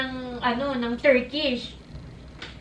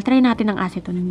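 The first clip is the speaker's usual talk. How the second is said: ordinary talk, nothing marked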